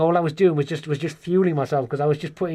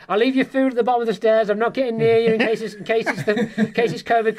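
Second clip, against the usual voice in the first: higher-pitched, fussing mum voice; imitated wary mum; 6 semitones higher than usual. Mum refuses to come near son upstairs, imitated wary mum. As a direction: play a mum keeping her distance, exaggerated.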